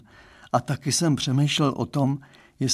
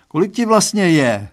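louder, higher acted question; sudden question; 5 semitones higher, 8 dB louder than usual. A narrator's reading. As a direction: blurt out a question, raised voice. Otec se najednou ptá. (náhlá otázka zvýšeným hlasem)

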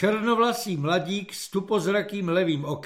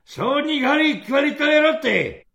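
barked order; 10 semitones higher, 7 dB louder than usual. Acted. Sergeant calls order.

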